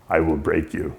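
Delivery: low, soft mock threat; imitated movie threat. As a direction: quote the boxer's threat, low and quiet. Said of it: "his favorite line from Rocky three".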